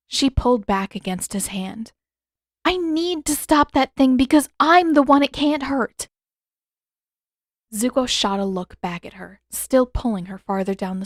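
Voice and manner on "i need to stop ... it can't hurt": put-on higher, louder young woman pleading her case; young heroine voice, heated; Katara, insistent. A narrator's reading. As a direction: argue urgently with Zuko.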